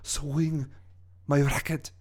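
French villain recalling torment, with long pauses; French accent. Acted. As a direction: lament haltingly in a French accent. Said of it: "my French accent".

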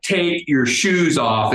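slow, deliberate, each word spaced out; slow insistent order. Retold or imitated. Security lady repeating her order to a foreigner, slow and deliberate. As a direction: spell it out slowly for someone who doesn't understand.

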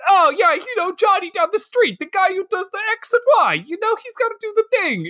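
very high, strained caricature voice; mock name-dropping gangster. Podcaster parodying movie characters dropping names, high mocking voice. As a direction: rattle it off high, as if everyone knows him.

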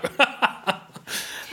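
an airy, high laugh; a soft breathless giggle; breathy laugh. Real, not acted. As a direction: laugh quietly and helplessly. Laughing at dad's answer.

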